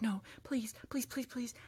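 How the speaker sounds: hushed, desperate re-enacted begging; whispered pleading. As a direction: beg under your breath, barely voiced. Re-enacts desperate pleading before the kick.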